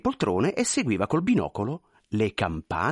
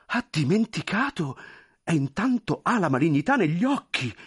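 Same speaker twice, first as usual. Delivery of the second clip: much higher, pleading, flustered voice; anxious clerk; 7 semitones higher than usual. Acted. Clerk pleading with the general, high and flustered. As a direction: beg forgiveness nervously.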